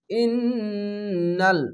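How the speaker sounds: slow, drawn-out melodic chant; Quran recitation. Shown as a demonstration. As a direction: intone it like tajweed recitation. Tajweed recitation of Quran word. (ধীর সুরেলা কুরআন তিলাওয়াত)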